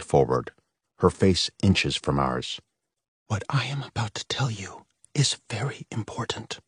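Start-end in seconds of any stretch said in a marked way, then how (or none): 3.3-6.6 tense hushed whisper; a mother's fearful warning whisper; urgent whisper. A narrator's reading. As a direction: whisper it, voice on edge. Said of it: "she whispered, her voice on edge".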